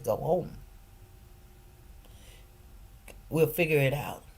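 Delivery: soft, low, calm reassurance; mother reassuring worried daughter. Retold or imitated. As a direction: settle her down gently.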